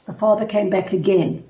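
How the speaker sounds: normal talking voice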